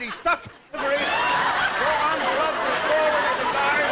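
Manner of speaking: loud, high, laughing shout. Real, not acted. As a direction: call out over the crowd. Host shouting during the race.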